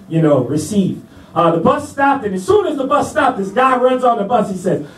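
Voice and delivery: ordinary delivery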